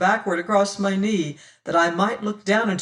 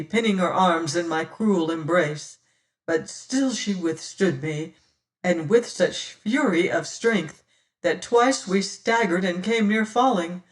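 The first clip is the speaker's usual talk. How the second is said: usual speaking voice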